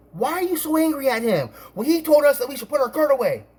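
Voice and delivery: high-pitched imitation of complainers; retold complaint. Voices managers questioning and the couple's complaint.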